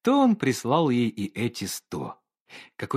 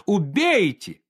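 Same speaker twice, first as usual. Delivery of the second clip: much higher and louder, shrill desperate cry; hysterical cry; 8 semitones higher, 4 dB louder, slower than usual. Acted. Olga screams at the painter to kill her, hysterical cry. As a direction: fling it at him in hysterics. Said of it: "крикнула Ольга Ивановна".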